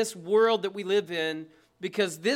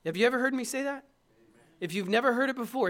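ordinary delivery

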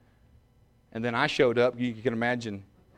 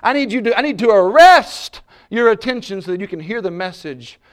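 loud, high preaching voice to grab the room; fiery pulpit delivery; full-force preaching; 7 semitones higher, 11 dB louder than usual. Acted. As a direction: boom it out to arrest their attention.

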